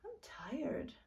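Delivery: put-on high, tired little-girl voice; a small, worn-out child; lightly voiced tired little girl. A narrator's reading. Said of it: "Akir said".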